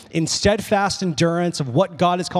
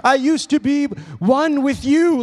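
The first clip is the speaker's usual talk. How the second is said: high-pitched, drawn-out mock-desperate plea; freaking out; 7 semitones higher, slower than usual. Daughter grabbing mom's leg, imitated high desperate pleading. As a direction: imitate a clinging child pleading. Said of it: "freaking out".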